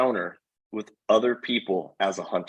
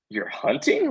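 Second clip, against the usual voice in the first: much higher, astonished question; bewildered. Retold or imitated. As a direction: ask it like you can't believe it, pitched way up. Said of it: "She's like".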